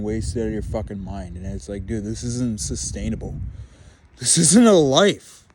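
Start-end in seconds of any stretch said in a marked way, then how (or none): 4.2-5.5 louder, higher, slow and pressed; frustrated outburst. Real, not acted. Venting to the camera about home, louder frustrated outburst. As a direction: it bursts out of him, drawn out. Ranting frustration boils over.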